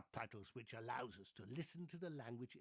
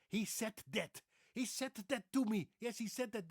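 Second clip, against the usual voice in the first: put-on high, loud Dutch-accented English; exaggerated Dutch English, "said that" as "set dat"; hard clipped consonants of a Dutch accent; 8 semitones higher, 6 dB louder than usual. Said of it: "they say".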